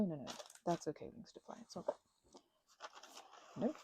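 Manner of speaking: ordinary talk, nothing marked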